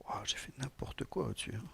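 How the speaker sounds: soft unvoiced whisper to himself; whispered aside. Real, not acted. Speaker noticing his own code mistake, whispered to himself. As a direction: breathe it out, a private oops.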